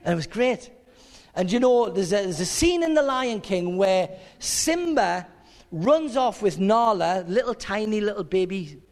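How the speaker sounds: ordinary delivery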